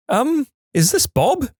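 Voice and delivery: put-on a hesitant older woman checking the line; older lady voice. Mistaken caller asking Paul if he is Bob, unsure.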